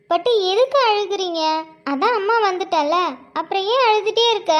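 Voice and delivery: high-pitched motherly cooing; soothing baby talk. Acted. Mother asking her little one why he still cries, soothing baby talk. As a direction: gently question a crying baby.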